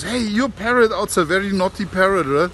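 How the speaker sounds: complaining woman. Retold or imitated. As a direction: play the insulted auntie complaining. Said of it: "and says".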